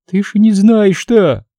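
high, slow tearful wail; holding back sobs. A narrator's reading. Mother about to tell her son bad news, choking back sobs. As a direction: fight down the sobbing, pitched high and drawn out. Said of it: "стараясь хоть как-то унять рвущиеся наружу рыдания".

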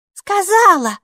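loud, high, cross retort; angry shout. A narrator's reading. Maiki from behind Kapo's back, an angry shout. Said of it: "сердито крикнула Майки".

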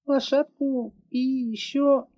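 put-on high, slow child's voice; girl listing what she wants; little girl. A narrator's reading. Girl asks pony.

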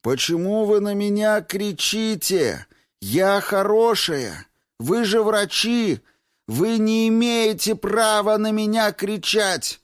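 high, loud, drawn-out wail; wailing complaint. A narrator's reading. Newly admitted former chief accountant protesting to doctors, loud high wail. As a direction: cry out indignantly to the whole ward. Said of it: "Она плакала на всё отделение".

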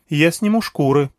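voiced businesslike female character. A narrator's reading. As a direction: say it practically, getting down to work. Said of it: "деловито сказала Анахита".

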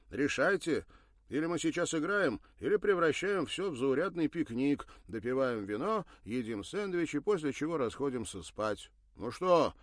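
loud, stern ultimatum. Acted. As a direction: lay down the law to the group. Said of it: "обвёл всех строгим взглядом".

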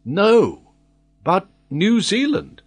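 high, firm contradiction; emphatic correction. A narrator's reading. Old sailor correcting his master, emphatic.

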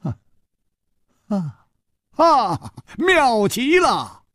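mounting ha-has into a high, slow cry; exult, stretching the words high; delighted exclamation. A narrator's reading. Delighted reaction to startling news.

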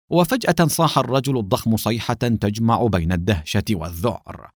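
usual speaking voice

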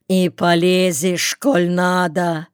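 usual speaking voice

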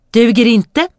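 high, loud curt rejection; curt dismissal. Acted. Princess rejecting a suitor, curt and loud. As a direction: dismiss him flatly, royal.

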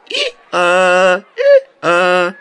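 loud braying hee-haw; donkey bray. A narrator's reading. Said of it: "выразительный ослиный рёв".

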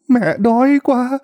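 put-on high, slow old woman's plea with đói stressed; beg, leaning on đói; whimpering plea. A narrator's reading. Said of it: "bà cũng mếu máo mà nói".

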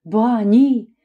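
ordinary delivery

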